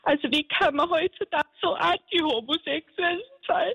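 unter Tränen, Stimme deutlich höher; weinend. Real, not acted. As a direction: klagt schluchzend und aufgewühlt. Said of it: "Bitte nicht mehr weinen". (echt weinend und aufgelöst)

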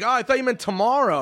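high fake innocence; playing dumb. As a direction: act clueless. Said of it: "And we're like".

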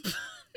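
high squeal of laughter. Real, not acted.

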